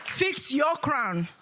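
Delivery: "Fix your crown" drawn out slow; slow emphatic. Real, not acted. Speaker urges the women slowly, drawn-out command.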